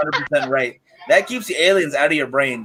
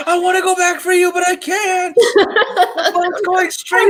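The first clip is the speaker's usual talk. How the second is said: a much higher, laughing panicked wife. Retold or imitated. Podcaster voicing the stranded wife, high laughing panic. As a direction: squeal it like the helpless wife on the boat. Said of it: "I wonder what the wife was thinking".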